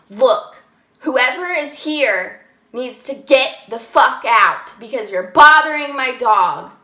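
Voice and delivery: loud yell, slowing down mid-line; retold yell. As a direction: yell at an unseen intruder to get out. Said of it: "I just yelled".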